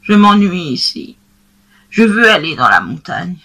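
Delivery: lightly voiced young goat, longing; a hint of a wistful little goat. Blanquette tells Monsieur Seguin she wants the mountain, lightly voiced. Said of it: "ma Blanquette".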